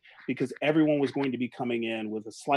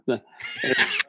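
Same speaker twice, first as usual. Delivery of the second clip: high laugh. Real, not acted. Laugh after the bit.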